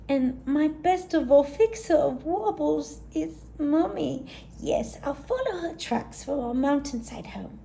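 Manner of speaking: put-on high, anxious young yak voice; a high, fretful little yak; worried little yak. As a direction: fret like a small worried sister. Gertie's worried voice.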